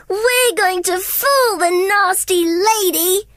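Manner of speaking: high, slow sly puppy voices; gleeful little plotters; giggling pups. Acted. Pups fooling Cruella, high gleeful voices.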